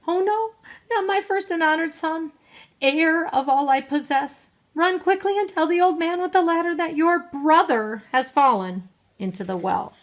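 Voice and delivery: high, urgent cry of dismay; distressed mother giving orders; alarmed mother. A narrator's reading. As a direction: send the child off for help urgently. Mother's alarmed speech, read again.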